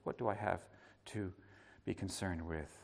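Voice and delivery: soft, low and gentle; a son speaking softly to his grieving mother; tender quoting. Pretend. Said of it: "look upon her".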